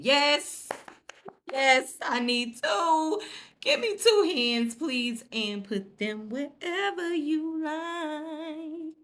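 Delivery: high, slow, loud swooning yes; mock flirty swoon. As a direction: gush like a smitten woman, hamming it up. Pretending to be the smitten woman.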